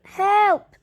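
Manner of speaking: loud, high call for help. A narrator's reading. Paddington stuck in the bath, calling out for help. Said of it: "Paddington tried calling out".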